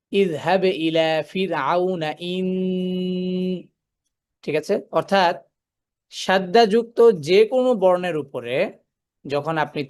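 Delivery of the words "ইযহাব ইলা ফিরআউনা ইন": very slow ইযহাব ইলা ফিরআউনা ইন- held on the doubled ন; stopping demo on nun. Shown as a demonstration. Teacher demonstrating stopping on a doubled nun, slow recitation. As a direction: stretch it out and stop on the ন with shadda. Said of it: "তখন আমি কিভাবে থামবো?".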